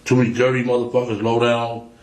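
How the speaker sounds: ordinary delivery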